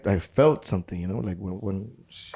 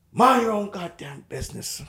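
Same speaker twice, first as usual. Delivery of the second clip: higher and louder, shouted out; raised warning; 8 semitones higher, 3 dB louder than usual. Retold or imitated. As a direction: snap it out loud and high. Same warning repeated.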